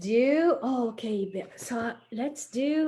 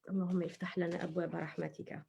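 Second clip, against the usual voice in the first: whispered prayer; 2 semitones lower, 11 dB quieter, much faster than usual. Shown as a demonstration. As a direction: whisper اللهم افتح لنا أبواب رحمتك quickly, no voice at all. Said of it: "When we go to the masjid".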